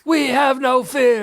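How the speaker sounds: high, slow chanted battle cry; Amazon war cry. Acted. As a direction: call it out like a warrior. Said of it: "And then they all say".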